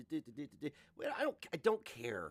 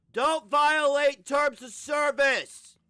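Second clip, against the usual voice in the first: slow, much louder, higher warning; shouted warning; 12 semitones higher, 15 dB louder, much slower than usual. Real, not acted. Host warning the streamer about terms of service, slow loud shout. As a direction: bellow it slowly like a stern warning.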